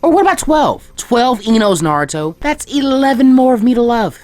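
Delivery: high, loud and gushing; excited pleading. Acted. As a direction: gush about the idea, building enthusiasm. Ino begging excitedly for jutsu.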